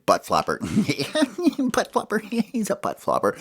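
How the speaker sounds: high giggles over the teasing name; giggling tease. Acted. Hope and Smalls giggling at fallen Ollie. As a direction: laugh through it, mocking.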